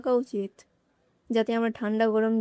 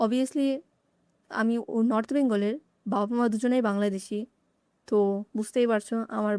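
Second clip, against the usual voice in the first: ordinary talk, nothing marked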